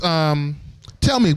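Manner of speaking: ordinary delivery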